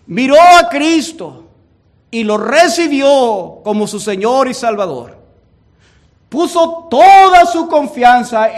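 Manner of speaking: loud, rising pulpit preaching; fiery evangelical preacher; preaching at full force. Real, not acted. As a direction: preach it hard, building to a climax.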